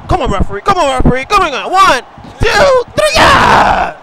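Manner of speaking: high, loud shout at the referee; shouted count ending in a triumphant yell; excited pinfall count. Pretend. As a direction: urge the ref on, then yell the count. Calling a pinfall in play.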